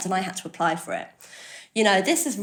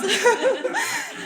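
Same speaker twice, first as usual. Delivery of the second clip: high, real laugh cutting in; 4 dB louder than usual. Real, not acted. Laughs again mid-sentence.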